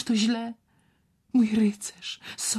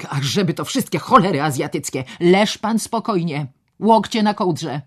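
commanding; 3 semitones higher, 8 dB louder than usual. Acted. Doctor barking orders at patient.

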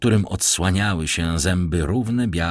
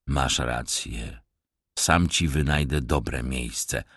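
low, soft mock-humble tramp; a sly beggar bowing with false politeness; rough vagrant voice; 5 semitones lower, 4 dB quieter, slower than usual. Vagrant feigning deference to the young master, low and soft. Stranger agrees.

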